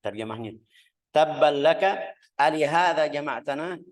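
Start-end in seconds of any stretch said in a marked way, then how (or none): 1.1-3.8 "Tabban laka" thrown out a bit higher and louder; a touch of scornful cursing; mild mock insult. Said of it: "Itu katanya yang buruk". (sedikit mencaci dengan nada mengejek)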